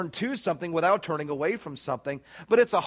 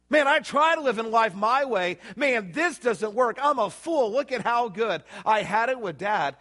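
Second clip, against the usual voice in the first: higher-pitched acted frustration with oneself; exasperated realization; 7 semitones higher than usual. Son admitting his way failed, exasperated. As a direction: preach it as the son fed up with himself. Acting the son's moment of realization.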